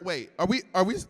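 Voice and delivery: normal talking voice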